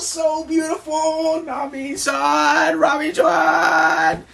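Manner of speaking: very high, drawn-out lovestruck wail; Sanji swooning. Acted. Sanji sees Nami and Robin, swooning cries. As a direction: gush helplessly over two beauties.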